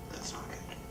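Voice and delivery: a dry, hushed aside; grim whisper. Real, not acted. Narrator to audience about the fall, whispered. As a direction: whisper it like bad news.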